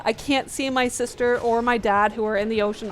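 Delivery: ordinary delivery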